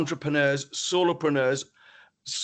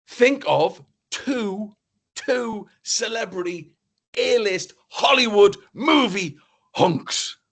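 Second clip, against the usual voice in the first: slow, drawn-out and louder; slow build-up; 6 semitones higher, 7 dB louder, slower than usual. Real, not acted. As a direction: stretch each word to tease them. Teasing build-up of the challenge.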